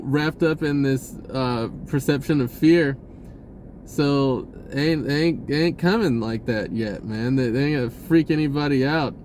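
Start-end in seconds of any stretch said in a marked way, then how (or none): none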